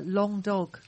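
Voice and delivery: ordinary delivery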